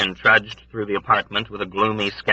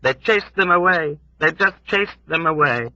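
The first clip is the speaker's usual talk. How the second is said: put-on high, lamenting old woman; old woman's voice; 9 semitones higher than usual. A narrator's reading. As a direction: voice the grieving old woman, pitched up. Old woman repeats herself.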